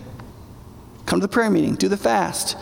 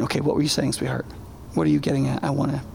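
gentle to wife; 7 semitones lower, 5 dB quieter, faster than usual. Retold or imitated. Turning back to wife softly.

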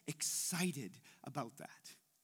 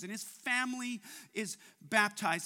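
usual speaking voice

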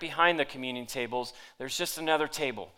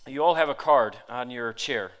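ordinary delivery

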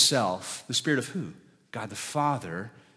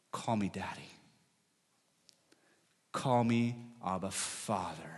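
tender invitation; 4 semitones lower, 8 dB quieter than usual. Acted. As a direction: coax them closer, gently.

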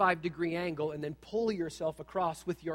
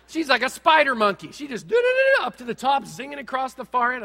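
higher and louder, amazed at her; excited, amazed; 6 semitones higher, 7 dB louder than usual. Real, not acted. Father amazed telling the congregation about his daughter, excited. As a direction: burst out in astonished excitement.

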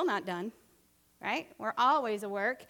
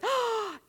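loud, high mock gasp; shocked gasp; 9 semitones higher, 5 dB louder than usual. Preacher realising her own fault, mock-shocked gasp. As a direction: gasp as if caught out.

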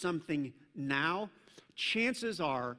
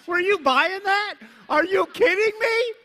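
high, loud and laughing; mock disbelief through laughter; laughing teasing; 13 dB louder than usual. Real, not acted. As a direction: laugh it out at the joke. Teasing the congregation.

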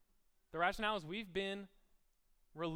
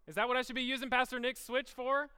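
higher and louder, faux-scandalized; mock shock; 9 semitones higher, 7 dB louder than usual. Pastor to congregation, mock shocked question. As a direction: play up the outrage.

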